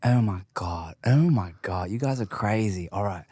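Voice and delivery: low, raspy-girl British imitation; British accent. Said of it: "with your accent".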